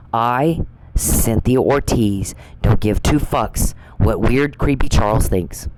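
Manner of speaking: very slow, every word weighed; slow, pointed declaration. Real, not acted. Naming herself to Charles, slow and defiant. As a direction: say it like a signed statement, slowly.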